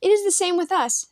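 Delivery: high, bright-pitched eager protest; eager argument. A narrator's reading. Hackett arguing with the ancient, high and eager. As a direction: push the point with boyish eagerness. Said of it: "Hackett argued eagerly".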